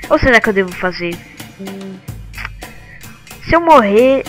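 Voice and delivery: ordinary talk, nothing marked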